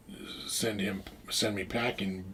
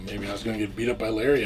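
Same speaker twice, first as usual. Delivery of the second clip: ordinary talk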